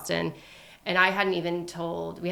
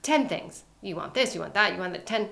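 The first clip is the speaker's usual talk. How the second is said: put-on Russian accent. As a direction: play the Russian waxer lecturing women. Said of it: "very thick Ru-, Russian accent".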